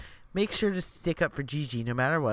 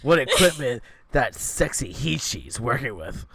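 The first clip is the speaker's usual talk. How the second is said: loud, campy, sassy shout; flamboyant bear voice; 9 dB louder than usual. Acted. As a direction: gush over him loudly.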